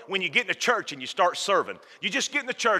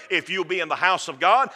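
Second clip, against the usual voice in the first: usual speaking voice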